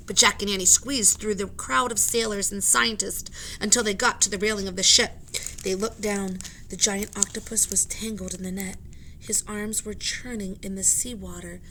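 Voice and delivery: usual speaking voice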